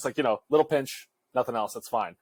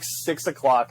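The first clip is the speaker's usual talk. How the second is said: ordinary talk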